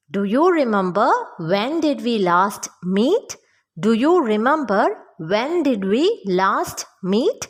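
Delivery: slow, measured question for learners; slow model sentence. Shown as a demonstration. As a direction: say it slowly, phrase by phrase. Teacher models English question slowly.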